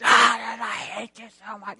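a sudden hammed-up inner outburst, then a hiss; seething behind a smile; mock inner rage. Mocking the churchgoer seething behind a nice smile. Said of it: "inside you're just".

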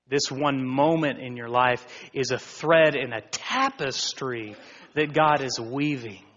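ordinary talk, nothing marked

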